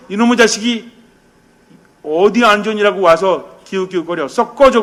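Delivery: loud, high mock scolding; angry scolding. A father scolding the son at the door, hammed-up fury. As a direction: drive the son off with a mock roar.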